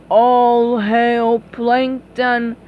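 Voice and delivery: slow monotone zombie voice; slow entranced mumble; mind-controlled mumble. Acted. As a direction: drone like a hypnotized king. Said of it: "mumbled Neptune".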